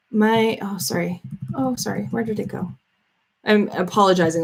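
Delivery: ordinary delivery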